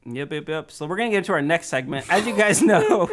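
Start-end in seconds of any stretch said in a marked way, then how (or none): 2.1-3.1 words broken by laughter; laughing. Real, not acted. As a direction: announce it while cracking up. Host starts segment, laughing.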